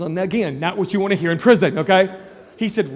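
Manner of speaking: ordinary talk, nothing marked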